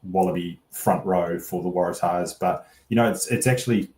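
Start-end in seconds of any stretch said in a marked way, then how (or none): none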